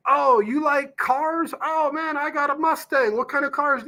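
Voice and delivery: imitating a salesman who fakes common ground; mock eager small talk. Salesman mocked for faking interest in cars, lightly hammed-up eager. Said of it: "It's like, ugh".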